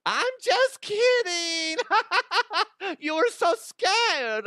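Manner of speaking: squeaky teasing voice with laughter; high laughing mock voice. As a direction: giggle through it, way up high.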